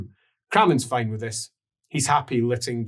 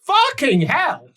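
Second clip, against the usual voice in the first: high, loud grumpy bargeman; Lucas voice; 12 semitones higher, 5 dB louder, slower than usual. Acted. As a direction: whine it out as a put-upon captain. Lucas sees party.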